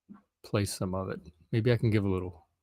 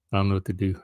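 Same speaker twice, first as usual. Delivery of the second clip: hoarse voice; 6 dB louder than usual. Real, not acted. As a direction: talk through a scratchy throat. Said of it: "I guess I'm getting old man voice".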